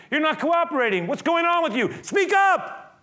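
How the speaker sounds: high, loud demand to speak up; frustrated Pilate. Acted. Pilate ordering Jesus to speak, loud and high. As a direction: snap at him to talk. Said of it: "He's frustrated".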